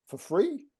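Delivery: high rising disbelief; can't believe they do it for nothing; incredulous. Retold or imitated. Coach learning posts are unpaid, high shocked question.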